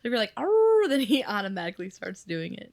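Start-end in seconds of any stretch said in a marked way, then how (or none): 0.4-0.9 short high loud howl. Retold or imitated. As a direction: howl like a dog to set him off. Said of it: "if you howl".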